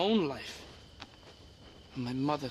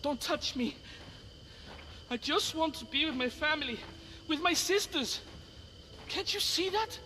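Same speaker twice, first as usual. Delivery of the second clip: higher, hurt and pleading; upset pleading; 10 semitones higher than usual. Acted. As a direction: push them away while holding back tears. Rescued boy rejects help, upset.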